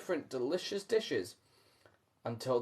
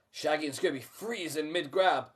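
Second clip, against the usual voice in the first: ordinary delivery, nothing marked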